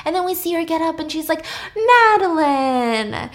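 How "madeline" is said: drawn-out call across the room, louder; calling out a name. Retold or imitated. Woman spotting old friend, calls her name out loud.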